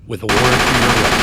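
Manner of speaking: loud announcement. Acted. Arrest announced over protest.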